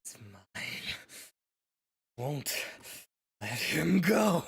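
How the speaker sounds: menacing creature voice that building into a roar; possessed monster voice. Acted. GM voices possessed dragon-woman.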